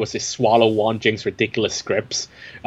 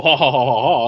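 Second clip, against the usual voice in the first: hammed-up evil ha-ha-ha; mock villain laugh. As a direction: cackle like a cartoon gangster who saw it coming. Mock villain laugh after parody.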